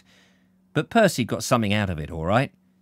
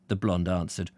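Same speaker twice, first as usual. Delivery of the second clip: plain reading voice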